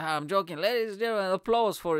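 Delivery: high, hasty stage patter; face-saving showmanship. Retold or imitated. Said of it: "I'm trying to save face".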